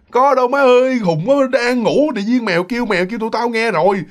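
high-pitched, exasperated denial; acted annoyed retort. Sleepy roommates tell the girl she is crazy, annoyed retort. Roommates argue back, woken up.

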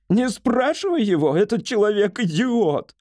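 high-pitched irate outburst; yelling. A narrator's reading. Patient yells at wife about the doctor, irate. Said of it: "Бедекер завопил".

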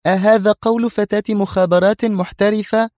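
high-pitched derisive question; scornful, nervy. A narrator's reading. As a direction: scoff at her in disbelief. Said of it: "ضحكة ساخرة عصبية وهي تستطرد". (بسخرية عصبية)

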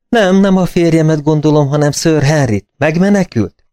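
a hint of a frightened lady; anxious woman. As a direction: ask urgently after Sir Henry. Mrs Stapleton voiced by narrator.

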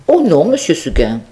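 the stubborn little goat answering; lightly voiced little goat. Goat answers her master. (petite chèvre têtue, voix légèrement plus aiguë)

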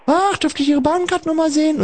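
a light high sweet conductress voice; imitating a conductress. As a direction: lightly play the nice ticket inspector. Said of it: "Immer wenn ich kontrolliert werde".

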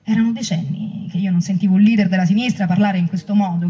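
usual speaking voice

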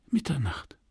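breathy whispered word. Acted. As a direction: breathe it, hushed, into the night. Lovers' secret night meeting.

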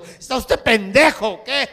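loud, slow, indignant scolding; a lady telling off a doctor, loud and slow. Acted. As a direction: scold him loudly and slowly, outraged.

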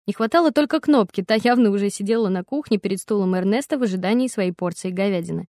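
usual speaking voice

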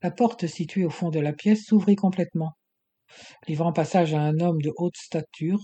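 usual speaking voice

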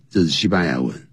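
ordinary talk

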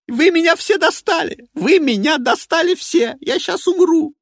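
high, loud hysterical yelling; screaming that everyone is fed up; enraged boss roar. Acted. Hysterical outburst.

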